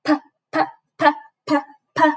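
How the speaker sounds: each "p" nice and short, a puff of air; short p sounds. Shown as a demonstration. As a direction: keep the "p" short and popped. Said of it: "Nice and short".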